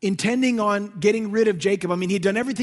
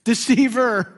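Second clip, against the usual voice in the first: confessing name; 3 semitones higher, 7 dB louder, slower than usual. Retold or imitated. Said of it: "What's your name".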